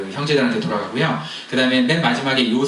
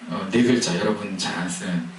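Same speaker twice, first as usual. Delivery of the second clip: ordinary delivery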